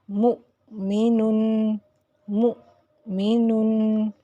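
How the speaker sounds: مؤمنن drawn out slowly, voice stopped on the hamza; Quranic recitation demo. Shown as a demonstration. Teacher reciting مؤمنن slowly as an example. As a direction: clip the hamza in مؤمنن with a jolt. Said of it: "جھٹکے کے ساتھ بند کیا".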